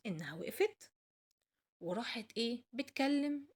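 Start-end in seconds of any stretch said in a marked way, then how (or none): none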